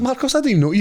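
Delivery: surprised greeting. Retold or imitated. Surprise meeting old acquaintance.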